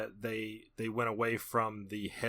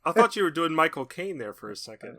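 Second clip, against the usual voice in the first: usual speaking voice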